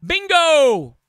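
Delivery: high, loud callout falling at the end; catchphrase shout. Acted. Player shouting the line over the game, loud. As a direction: yell it out like a catchphrase.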